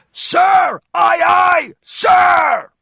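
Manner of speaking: very high, loud parade-ground scream; screamed at attention. Acted. Josh in Airborne mode answering the bosun with a full scream. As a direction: bellow it like a paratrooper at attention. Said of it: "snapped to attention and screamed".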